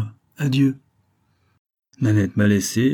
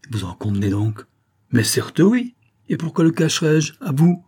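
high, loud retort; defiant; 6 semitones higher, 4 dB louder than usual. A narrator's reading. Saphir openly admits it to the vicomte, defiant. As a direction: fling it back proudly.

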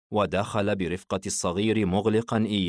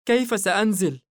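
cried out; 12 semitones higher, 4 dB louder than usual. Acted. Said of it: "صرخت به".

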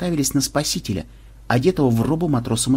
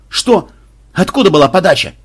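high, loud demanding questions; startled shouting; 6 semitones higher, 8 dB louder than usual. Acted. Captain bursts onto the bridge mid-attack, shouting questions. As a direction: demand to know where it came from.

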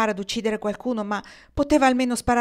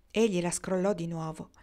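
usual speaking voice

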